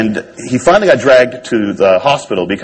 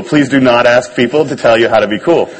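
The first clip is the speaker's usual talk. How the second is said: ordinary talk, nothing marked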